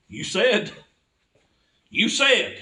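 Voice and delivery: you said, you said, pleading; desperate plea. Retold or imitated. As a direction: beg God, climbing each time. Begging God on the floor.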